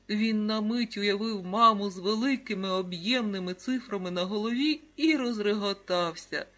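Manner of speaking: ordinary delivery, nothing marked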